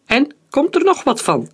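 high, defiant shout to the sky; defiant high-pitched taunt; calling out. A narrator's reading. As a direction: call up a challenge, impatiently. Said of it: "riep hij met zijn armen".

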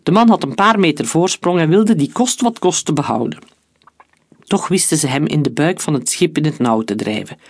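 ordinary delivery

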